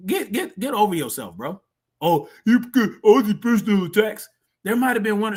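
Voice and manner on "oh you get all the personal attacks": louder mock-crying whine; mocking imitation. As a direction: mimic a crybaby complaining. Mimics the host's complaint about attacks.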